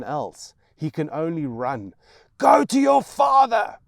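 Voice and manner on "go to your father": very loud, high shout; scream. Acted. Mother screaming as her son runs away, shouting. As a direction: hurl it after him.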